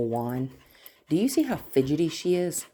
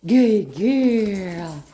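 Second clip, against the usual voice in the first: upbeat praise; 6 semitones higher, 8 dB louder than usual. Real, not acted. Praising dog.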